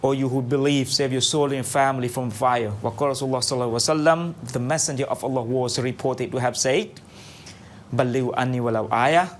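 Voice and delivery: measured, solemn opening recitation; formal invocation. Acted. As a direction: recite the blessing steadily. Opens radio program with Islamic invocation.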